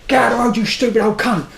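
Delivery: high, irate yell at a pedestrian; retold road-rage shout. Driver yelling at an old man in the road, irate shout. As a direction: act out shouting through a car window. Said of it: "I'm shouting at the window".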